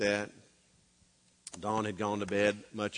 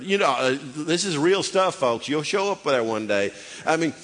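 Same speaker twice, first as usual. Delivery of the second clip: higher and louder, driving; preacher's building climax; full-force preaching; 10 semitones higher, 9 dB louder than usual. Real, not acted. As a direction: push it home to the crowd.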